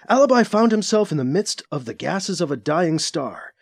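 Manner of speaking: ordinary delivery, nothing marked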